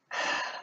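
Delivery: long, soft exhale; breathy sigh of release; sigh of relief. Shown as a demonstration. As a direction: let out a deep, quiet sigh. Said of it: "take a deep sigh of relief".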